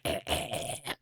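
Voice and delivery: breathless whispered sounds of exhaustion; whispered tired eh, eh, eh. Retold or imitated. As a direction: wheeze out each eh like you're spent. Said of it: "I'm gonna be like".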